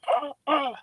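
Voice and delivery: high, light imitated gasps of emotion; a touch of a tearful gasp, imitated; moved gasps. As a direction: lightly mimic her moved little ahs. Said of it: "and she's like".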